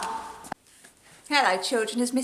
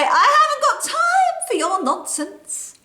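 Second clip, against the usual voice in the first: high, louder, bossy scolding; bossy sister; 13 semitones higher, 4 dB louder than usual. Acted. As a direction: talk down to the little brother, higher. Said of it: "Sometimes I say".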